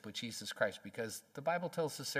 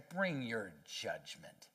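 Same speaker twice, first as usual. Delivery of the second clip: lower, slow and softer, a dark dare; defiant taunt; 5 semitones lower, 6 dB quieter, much slower than usual. Retold or imitated. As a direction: dare God slowly under the breath. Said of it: "so defiant toward God that they say".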